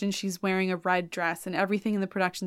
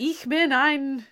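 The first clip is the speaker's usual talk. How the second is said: slow, raised-pitch mock declamation; grand proclamation; 5 semitones higher, slower than usual. Dutchman revealing himself in German, mock grandiose. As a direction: declaim it like an opera villain.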